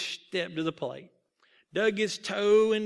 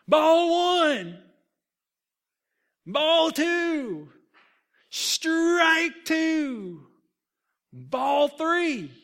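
high, loud, drawn-out pitch calls; umpire's calls; 10 semitones higher, 8 dB louder, much slower than usual. A narrator's reading. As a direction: shout each count like an ump. Umpire calling pitches in the game.

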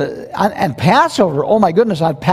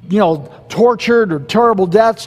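ordinary talk, nothing marked

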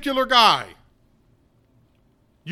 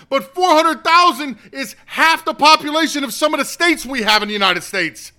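angry yelling; 6 semitones higher, 7 dB louder than usual. Real, not acted. Outburst after failing to hold back.

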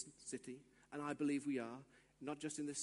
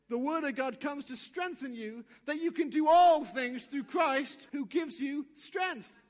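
loud, emphatic proclamation; full-force preaching; 5 semitones higher, 8 dB louder than usual. Real, not acted. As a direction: drive it home, stretching the final words.

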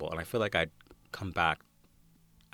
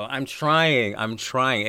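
loud, higher-pitched protest; mock protest; 7 semitones higher, 10 dB louder, much slower than usual. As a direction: protest loudly at being teased. Protesting to husband who mocks accent.

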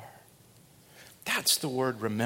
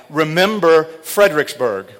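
"Remember" called out loud and high; rally the men with a loud cry; battle cry; 5 semitones higher, 11 dB louder than usual. Retold or imitated. Commander crying out as his men charge downhill, loud rallying call. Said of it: "he cried out".

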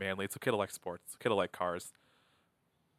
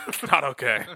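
laughing; 3 semitones higher, 8 dB louder than usual. Real, not acted. Laughing denial among friends.

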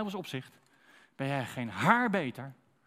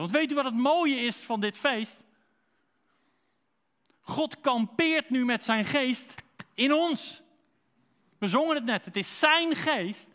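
higher, louder, slowed preaching climax; preaching at full force; 9 semitones higher, 6 dB louder, slower than usual. Acted. Preacher telling the congregation God lives in them, fervent and loud. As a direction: proclaim it to the whole church, slow on key words.

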